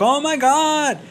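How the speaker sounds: high, drawn-out imitation of bawling; hammed-up weepy astonishment; mock tearful cry. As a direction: imitate an overwhelmed fan starting to cry. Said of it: "she starts crying, and she's like".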